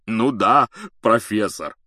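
loud, happy agreement; overjoyed giant. A narrator's reading. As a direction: agree heartily, beside yourself with joy. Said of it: "Хагрид был сам не свой от счастья".

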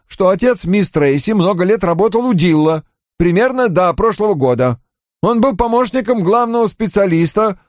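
high, eager-to-justify report; offended young subordinate reporting. Acted. Voices Johnny reporting. (высоким голосом обиженного подчинённого)

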